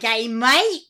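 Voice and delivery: put-on loud, high, overdone Australian accent; hammed-up Aussie accent; bad mock Australian accent. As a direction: parody a foreigner's Australian accent. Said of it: "You may think you do a great Australian accent".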